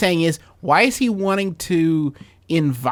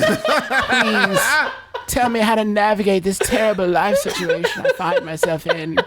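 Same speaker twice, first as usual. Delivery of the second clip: laughing mock plea; 5 semitones higher than usual.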